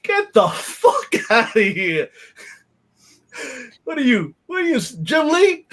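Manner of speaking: slow laughing scoff rising to a high shout; laughing ridicule of a fan wanting his autograph. Retold or imitated. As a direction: scoff at the fan, then yell mockingly. Mocking autograph seekers.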